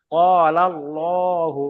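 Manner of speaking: ক আলাল্লাহু stretched very slowly; slow drawn-out recital. Shown as a demonstration. Teacher slowly reciting an example for the class. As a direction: chant it slowly, syllable by syllable. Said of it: "যেমন".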